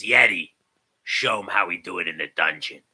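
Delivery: mock menace in a dropped voice; villain's command.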